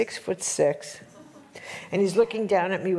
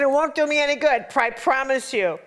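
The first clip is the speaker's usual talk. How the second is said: louder and much higher, pleading; loud, high pleading to a friend; emphatic insistence; 6 semitones higher, 4 dB louder than usual. Retold or imitated. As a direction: insist hard that it is no use.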